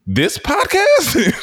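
very high, slow, stretched disbelief; incredulous squeak; disbelieving. Retold or imitated. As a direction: stretch it out in total surprise. Stunned reply in retold story.

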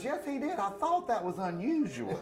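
high, innocent motherly voice; mock-naive, bemused mother; imitated puzzled mom. Puzzled mom answers, acted.

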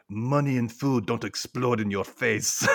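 slow, deliberate put-on accent; Mayakovsky accent. Recapper voices Mayakovsky answering Penny, put-on accent. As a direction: retort slowly in a mock foreign accent. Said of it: "I have to do this accent with him".

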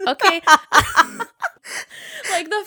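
high burst of laughter; laughing. Real, not acted. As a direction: crack up at the absurd review. Laughing at review.

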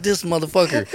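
high, laughing mock outrage; laughing disbelief. Friend retelling how he laughed off Sean's lie, laughing disbelief. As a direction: scoff through a laugh at the betrayal.

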